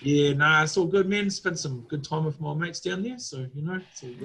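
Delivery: put-on easygoing New Zealand bloke accent. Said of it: "You also sound exactly like Taika Waititi".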